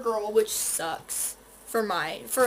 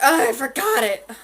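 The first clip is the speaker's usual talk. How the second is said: mock dismay; 10 dB louder than usual. Makeup routine mishap.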